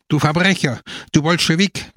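understated quarrelling screech; mild mock screech. A narrator's reading. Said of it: "kreischte Gina".

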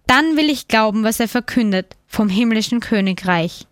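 ordinary delivery, nothing marked

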